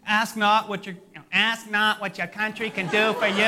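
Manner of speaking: lightly voiced, hammed-up Kennedy; bad Kennedy impression. Pastor mocks Kennedy to his church, a loose hammy imitation. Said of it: "That's a horrible Kennedy impression".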